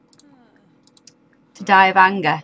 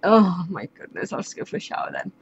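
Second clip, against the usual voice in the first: imitating a sigh of resignation, trailing into a hushed mumble; resigned character; 4 semitones lower, 4 dB quieter, faster than usual. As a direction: start exasperated, then drop to a fast, voiceless mutter. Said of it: "And he's like".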